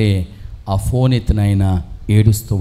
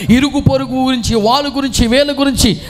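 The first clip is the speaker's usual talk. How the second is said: fiery preaching; 13 semitones higher than usual. Acted. Preacher urges believers to share God's word, fiery. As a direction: rouse the congregation to speak up.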